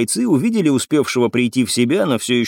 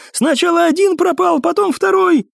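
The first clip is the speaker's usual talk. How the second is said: high-pitched frantic wail; panicked cry. A narrator's reading. Cadet tells how his comrades vanished, crying out. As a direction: cry it out in desperation. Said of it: "крикнул в ответ курсант".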